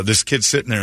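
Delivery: ordinary delivery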